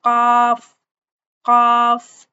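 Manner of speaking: काफ said loud and heavy from the back of the tongue; loud teaching demo of काफ; heavy qaf demo. Shown as a demonstration. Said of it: "जुबान का जो हमारा पिछला हिस्सा है उसको आप पहले तालू की तरफ लगाएंगे".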